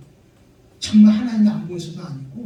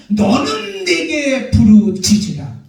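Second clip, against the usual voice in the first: loud, slow, drawn-out proclamation; full-force preacher's declamation; fervent preaching; 5 dB louder, slower than usual. Acted. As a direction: thunder the verse out to the congregation.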